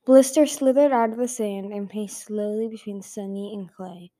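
plain reading voice

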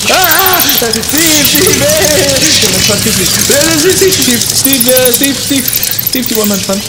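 high, loud frantic cries for help; panicked screaming. Acted. Man attacked by bats screaming for his friend, panicked. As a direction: shriek his name over and over. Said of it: "Ich schrei doch hier nicht so herum".

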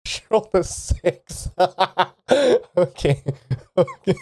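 high, giggly announcement; laughing through words. Real, not acted. Game master announcing the roll through giggles. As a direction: speak while still giggling.

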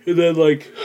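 words stretched by a yawn. Real, not acted. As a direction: talk through a real yawn. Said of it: "I keep yawning".

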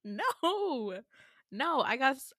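usual speaking voice

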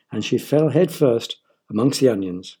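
ordinary delivery, nothing marked

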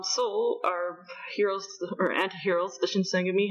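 normal talking voice

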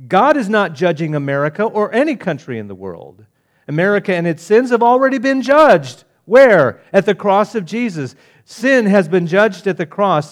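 preaching harder as the rebuttal comes; building to fiery preaching. Acted. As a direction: hammer the rebuttal from the pulpit.

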